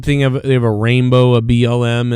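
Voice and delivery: usual speaking voice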